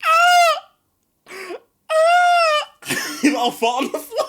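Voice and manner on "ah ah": very high cries of pain; mock pain yelp. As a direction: yelp high as if hand just hit wood. Pain after punching a tree.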